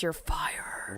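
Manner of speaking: breathy whisper. Pretend. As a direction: whisper it like a quiet threat. Boss threat finished under breath.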